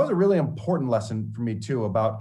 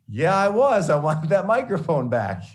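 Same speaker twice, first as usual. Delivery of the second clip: emphatic admission; 6 semitones higher, 6 dB louder than usual. Retold or imitated. Admitting anger to mentor.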